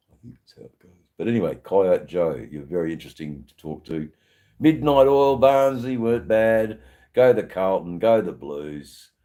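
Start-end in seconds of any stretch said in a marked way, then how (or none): 0.1-0.9 whispered aside. Real, not acted. Repeats line again, whispered. 4.6-9.1 Aussie cheer. Real, not acted. Cheering Aussie bands and team.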